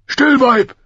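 loud, high, sharp royal rebuke; furious king silencing his wife; angry snapping. A narrator's reading. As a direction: snap at her to shut her up. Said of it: "fuhr Robert sie an".

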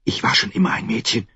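fast whisper. Acted. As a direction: whisper it quickly in the dark. Arya whispers in the dark.

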